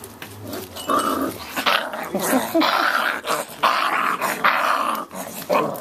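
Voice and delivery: long helpless wheezing laugh; breathy laughing. Real, not acted. Owner swarmed by puppies, wheezing laughter. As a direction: laugh in bursts, mostly breath.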